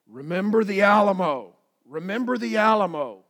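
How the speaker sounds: loud called-out Remember the Alamo; rally the troops, loud and proud; rallying cry. Retold or imitated. Preacher calling out the Texas battle cry, loud. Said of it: "it was what".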